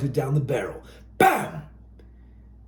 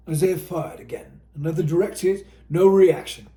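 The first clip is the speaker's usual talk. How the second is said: ordinary delivery, nothing marked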